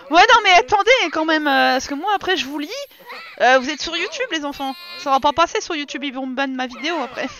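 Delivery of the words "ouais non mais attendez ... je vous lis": loud, high, laughing protest; excited protest. Real, not acted. Loudly protesting to the chat, half laughing. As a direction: wave off the weird chat, fired up.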